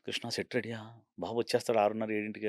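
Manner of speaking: quiet, breathy half-whisper; hushed director. Retold or imitated. Director quietly asking the art director before dawn, hushed. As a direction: ask softly whether the set is ready.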